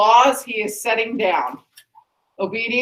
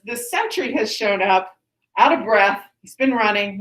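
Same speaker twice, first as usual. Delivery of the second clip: ordinary delivery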